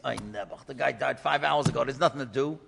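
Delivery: a resigned low murmur over the body; low, soft pitying sigh. Retold or imitated. Herschel Weber finding the man long dead, low pitying murmur.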